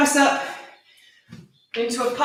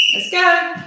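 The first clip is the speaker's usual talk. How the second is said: usual speaking voice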